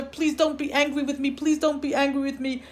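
put-on high, monotone pleading of a child; begging child. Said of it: "to cry and to beg his father".

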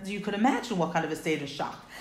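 usual speaking voice

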